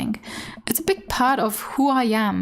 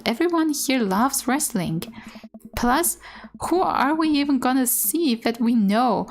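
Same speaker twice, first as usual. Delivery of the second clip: ordinary talk, nothing marked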